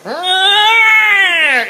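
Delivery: high loud creature yell. Retold or imitated. [name] voicing Ukla charging off, high creature yell. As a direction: let out a wild beastly cry. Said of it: "Ukla goes".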